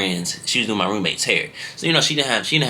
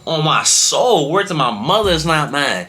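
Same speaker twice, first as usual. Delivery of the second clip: insistent higher plea of innocence; pleading denial; 6 semitones higher, slower than usual. Retold or imitated. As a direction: swear it on your mother. Protesting innocence to cop.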